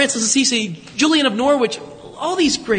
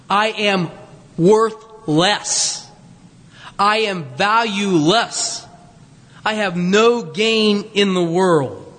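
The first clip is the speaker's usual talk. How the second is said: slow solemn emphasis; much slower than usual. Acted.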